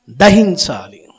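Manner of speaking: sudden loud shout; shouted command. Real, not acted. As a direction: fling the word out loud. (గట్టిగా అరిచి)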